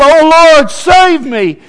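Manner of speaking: very high, loud desperate cry; last-ditch shout to heaven. Acted. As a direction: wail the plea at full voice. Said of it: "And he's crying out".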